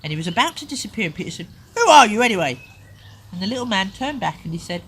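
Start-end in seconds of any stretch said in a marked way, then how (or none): 1.8-2.6 loud, high demand; angry demand. Acted. Parent voicing Peter demanding who the man is, angry demand. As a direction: bark the question at him.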